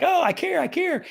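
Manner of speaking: high-pitched insincere concern; faked caring. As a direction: gush with put-on care. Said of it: "if you try to fake it".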